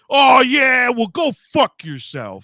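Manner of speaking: high, loud snapping comeback; pointed shout. Retold or imitated. Man losing it at the lady, loud and pointed. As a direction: lash out at her. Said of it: "very pointed".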